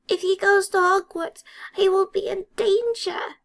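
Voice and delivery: high, loud elf cry of warning; frantic elf warning; alarmed elf. A narrator's reading.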